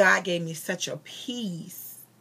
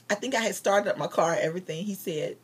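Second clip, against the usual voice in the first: ordinary talk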